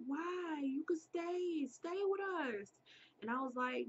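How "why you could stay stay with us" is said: higher-pitched pleading friend; coaxing. Retold or imitated. Friend urges her to stay with them, coaxing. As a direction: imitate a friend coaxing in a high voice.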